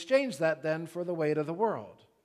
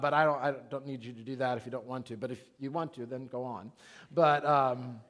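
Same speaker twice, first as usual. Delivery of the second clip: ordinary delivery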